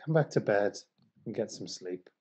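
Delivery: low, drowsy mumble from under the covers; sleepy murmur. Acted. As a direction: coax them back to bed, half asleep. Sleepy spouse pleading from bed.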